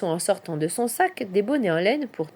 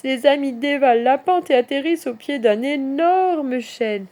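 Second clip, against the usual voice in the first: usual speaking voice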